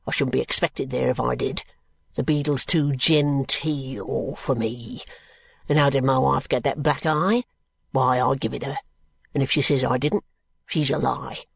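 low, rough-spoken working man, defiant; low-pitched, dialect-heavy, contemptuous brickmaker; surly drunken brickmaker. Acted. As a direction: growl out a sullen answer to an unwelcome visitor. Brickmaker's rant voiced within the narration.

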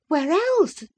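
high-pitched retort; voiced young wife. A narrator's reading. Wife answers husband's doubt about parlour, high retort. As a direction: toss it back high, as the wife.

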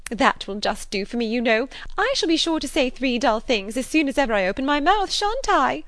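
high, loud, eager chatterer; Miss Bates. A narrator's reading. Spinster seeking everybody's assent, high excited prattle. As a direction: gush along in good-humoured self-mockery. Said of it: "exclaimed Miss Bates".

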